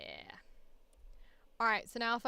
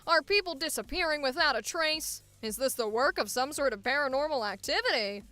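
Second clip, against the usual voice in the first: brisk transatlantic newsreel announcer; 1950s corporate safety film narrator; old-timey announcer. Acted. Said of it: "That's a fun voice".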